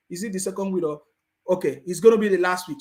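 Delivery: usual speaking voice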